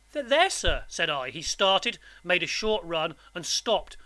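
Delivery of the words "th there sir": high, stammering, frightened answer; nervous child's voice; timid. A narrator's reading. Boy answers convict about his mother, timid. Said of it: "I timidly explained".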